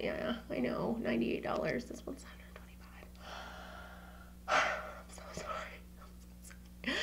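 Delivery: hushed, breathy confession of the price; sheepish apology under the breath; guilty whisper. Real, not acted. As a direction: whisper it like an embarrassed secret.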